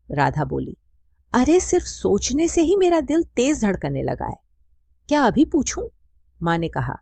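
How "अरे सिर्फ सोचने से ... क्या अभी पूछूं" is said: high, eager and flustered; nervous excitement. A narrator's reading. Radha flustered and eager about asking now, high voice. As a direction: heart racing, barely containing it.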